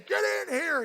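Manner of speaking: high-pitched imitation of a boss calling out; short retold holler; mock yell. Foreman yelling across the factory, mock shout. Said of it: "he'll yell the person's name".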